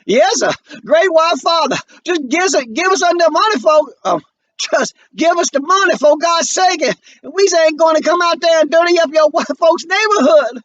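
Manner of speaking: crying, begging. Acted. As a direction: grovel through tears, voice cracking high. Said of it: "he starts to cry".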